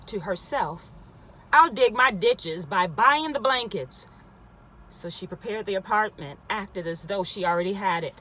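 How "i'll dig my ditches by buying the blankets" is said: firm declaration. Acted. Woman answers her doubting thoughts.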